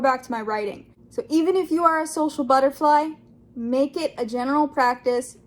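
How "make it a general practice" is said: ordinary delivery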